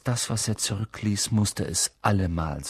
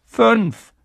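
put-on much higher, louder boy voice; quick correction; 13 semitones higher, 9 dB louder than usual. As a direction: blurt out the correction. Ron correcting Harry.

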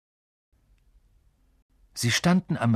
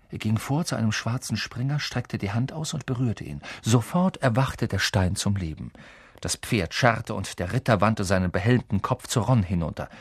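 ordinary delivery, nothing marked

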